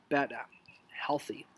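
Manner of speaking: breathy whisper; hushed one-word whisper; whispered aside. Acted. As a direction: whisper it like a guilty secret. Whispered aside on healthy eating.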